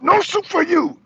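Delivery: hammed-up soup Nazi; soup Nazi refusal. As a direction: snap the refusal down at him.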